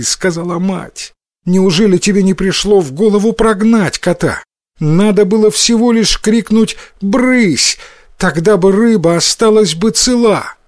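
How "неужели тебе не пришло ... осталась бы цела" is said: higher-pitched, mildly exasperated mother; scolding mother. A narrator's reading. Mother tells her son he should have chased the cat, scolding. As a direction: reproach a silly child, voice raised in pitch.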